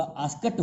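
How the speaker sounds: आस्कट said with a final t sound, not d; correct pronunciation. Shown as a demonstration. Teacher tells students how asked is spoken, आस्कट with t. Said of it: "उच्चारण जो है आस्कड नहीं होगा".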